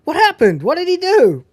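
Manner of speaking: mock kid brother voice; confused little brother. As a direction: ask like a clueless kid. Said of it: "he's like".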